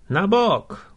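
high, loud warning shout; shouted command. A narrator's reading. Coachman to the crowd in the road, commanding shout. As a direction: order them out of the way. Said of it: "krzyknął Stangret na gromadę".

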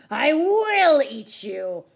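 slow, high, very loud cry; roared threat. A narrator's reading. Theodore chasing the boy, loud drawn-out menacing cry. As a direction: stretch the threat into a loud roar.

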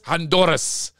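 Spanish pronunciation. Shown as a demonstration. Host admiring guest's birthplace, "Honduras" in a Spanish accent. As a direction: give "Honduras" a showy Spanish r. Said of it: "Not just Honduras".

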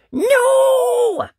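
high, loud drawn-out no; cross refusal. A narrator's reading. Cross boy answering Mum, high loud refusal. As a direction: holler a cross, stubborn no. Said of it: "all hot inside and cross".

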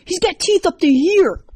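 very high, loud frantic yell; screaming. A narrator's reading. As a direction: shriek it in alarm at your friend. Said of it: "went right back to screaming".

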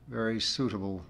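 plain reading voice

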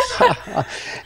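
a high, breathy mock girlish giggle; imitated giggle. Telling the bar joke, a hammed-up giggle for the girl. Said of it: "she starts laughing".

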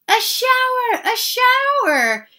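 high, loud excited squeals; children screaming. A narrator's reading. Four children see the spraying hose, screaming with delight. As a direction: scream it like thrilled kids, drawing out the repeat. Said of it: "screamed Missus Ralph's four children".

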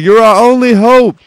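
squeaky mock-desperate plea; voicing the captive. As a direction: beg like a desperate captive, hammed up. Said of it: "He's like".

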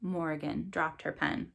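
plain reading voice

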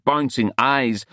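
ordinary delivery

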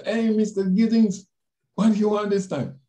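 high, loud, cheery Spanish lady's greeting; lightly voiced cheerful manager; imitating the manager. Manager greeting him again, voiced.

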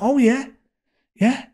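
a hint of a chirpy young barmaid; mock barmaid voice.

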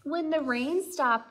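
ordinary delivery, nothing marked

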